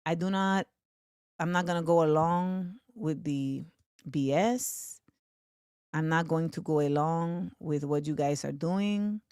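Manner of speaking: low, soft, steady refusal; imitating Claudia Sheinbaum calmly refusing Trump. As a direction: refuse without raising the voice. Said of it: "She does so in a very calm voice".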